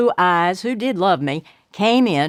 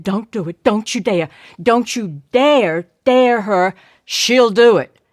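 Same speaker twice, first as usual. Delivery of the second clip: from a plain warning up to a loud plea; rising alarm, getting louder each line; warning building; 4 dB louder than usual. Acted. Big brother warning Claude not to dare her, building louder.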